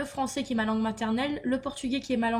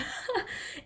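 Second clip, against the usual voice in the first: breathy giggle; 9 semitones higher, 7 dB quieter than usual. Real, not acted. Laughing at her childhood letters.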